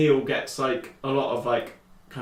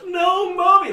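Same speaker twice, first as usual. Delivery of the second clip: put-on high-pitched, louder kid's wail; mock sad child; 6 dB louder, slower than usual. Kid just there as mother is shot, mock sad. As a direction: imitate a kid's hammed-up plea. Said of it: "like, sad and whatever".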